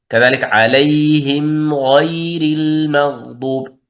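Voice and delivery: slow chant of عليهم غير المغضوب; recited example. Shown as a demonstration. Teacher recites another example, slow chant. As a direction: stretch the mim before غير slowly. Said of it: "الميم عند الغين".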